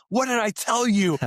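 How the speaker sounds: high, slow stern parent scolding; mock angry scold. Dad scolding his kid for scribbling, mock angry.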